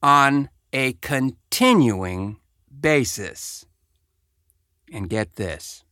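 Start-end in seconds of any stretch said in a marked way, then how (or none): 0.0-3.7 each word laid out slowly and pointedly; slow deliberate emphasis on "On a continuing basis."; slow pointed repeat. Shown as a demonstration. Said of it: "repeats quoted phrase for emphasis".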